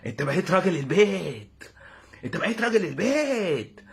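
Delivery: slow, put-on demonic proclamation; hammed-up sinister neighbour; evil devilish voice. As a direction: announce it slowly like a gloating devil. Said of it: "ضحكة شريرة شبه بتوع الشيطان كده".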